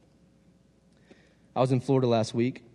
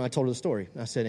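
ordinary talk

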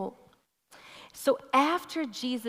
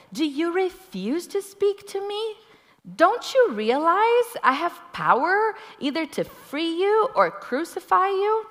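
higher, louder indignant questioning; indignant Pilate; 10 semitones higher, 5 dB louder than usual. Acted. Pilate confronting a silent Jesus, high and indignant. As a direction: demand an answer from a silent prisoner.

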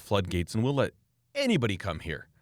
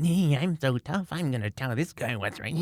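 mocking keyboard cowboy; 3 semitones higher than usual. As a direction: sneer it out like a basement know-it-all. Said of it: "little keyboard cowboys in their mommy's basement".

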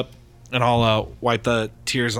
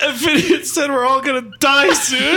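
much higher, louder laughing squeal; 6 dB louder than usual. Real, not acted. Player laughing to the newcomer about grim prophecy.

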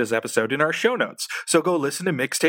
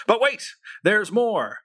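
But wait pitched up; infomercial; 7 semitones higher, slower than usual. Acted. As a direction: classic TV pitch, high and eager.

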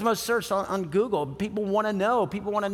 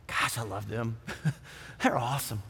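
much softer, lower murmur of love; a soft, lower, fond hush; tender aside; 9 semitones lower, 8 dB quieter than usual. Retold or imitated. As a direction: sigh it to yourself. Sudden love for your spouse.

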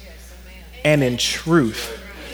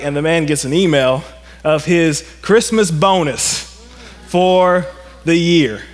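ordinary talk, nothing marked

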